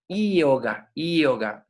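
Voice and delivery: "yoga" opening on a vowel-like i, ee-oga; correct Sanskrit pronunciation. Shown as a demonstration. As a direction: glide into "yoga" from a long ee. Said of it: "bien pronunciado sería".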